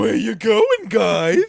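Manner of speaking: imitating Ernie. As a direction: echo the puppet voice back, cracking up. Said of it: "he sounds like Fred Wynn".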